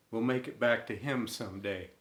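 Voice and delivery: ordinary delivery, nothing marked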